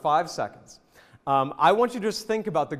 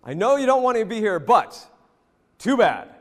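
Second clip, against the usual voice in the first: louder, rousing call to the troops; rallying shout; 4 semitones higher, 5 dB louder than usual. Pretend. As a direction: bark it like an officer rallying men. Teacher voices audience's speech loudly.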